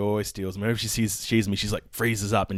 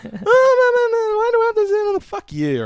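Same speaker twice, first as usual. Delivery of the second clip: very high, loud whiny squeal; mock whiny voice; 9 dB louder than usual. Shoplifter mocked as whining brat, squeaky squeal. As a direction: whine it like a petulant kid.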